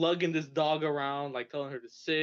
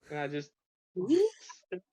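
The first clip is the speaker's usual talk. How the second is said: laughing; 2 semitones higher than usual. Real, not acted. Guest laughing at own story.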